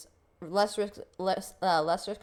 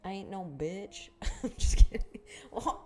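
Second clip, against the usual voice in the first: ordinary talk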